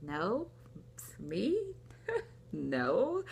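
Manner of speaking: rising, questioning denials; retold incredulous denial. Retells refusing idea of pregnancy.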